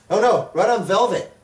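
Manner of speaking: ordinary talk, nothing marked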